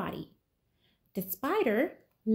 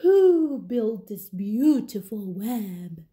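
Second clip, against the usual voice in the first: slow, louder owl question; 4 dB louder than usual. Acted. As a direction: ask it slowly like a wise old owl. Owl asks about web.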